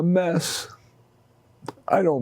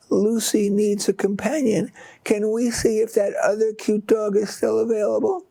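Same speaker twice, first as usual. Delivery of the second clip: put-on higher, small young girl's voice; a little girl asking sweetly for more; peeping girl; 7 semitones higher than usual. Daughter asks father for a second dog, small high voice. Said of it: "Emily peeped".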